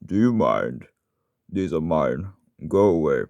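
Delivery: lightly voiced grumpy engine character; slow, slightly put-on character voice close to your own; Boko. Shown as a demonstration. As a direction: say it as a character brushing someone off. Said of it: "here's my voice for Boko".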